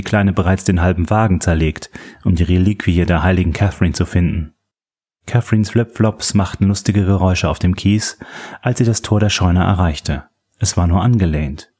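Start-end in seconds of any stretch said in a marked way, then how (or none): none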